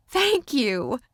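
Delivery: high squeak of overwhelmed thanks; high-pitched, tearful. A narrator's reading. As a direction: thank them as if close to crying. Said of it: "She spoke in a high-pitched tone that sounded a little like she was crying".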